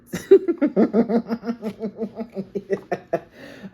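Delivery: loud high burst of laughter; amused laugh. Real, not acted. Reader laughing at her own slang, loud and high. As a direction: crack up at your own joke.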